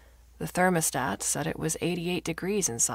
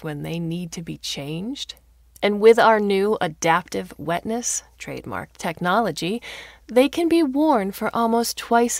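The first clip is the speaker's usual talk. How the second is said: peppy ad pitch; 3 semitones higher, 6 dB louder than usual. Acted. Smart diaper ad hectoring Martha from billboards, peppy sales pitch. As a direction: sell it like an advert.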